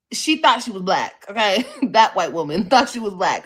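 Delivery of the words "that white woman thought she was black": loud, amused telling; loud and laughing in amused disbelief. Real, not acted. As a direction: say it through real laughter. Laughing while describing her friend.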